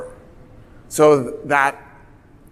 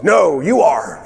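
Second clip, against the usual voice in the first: slow defiant shout back; mock shout; slower than usual. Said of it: "And Mossadegh goes".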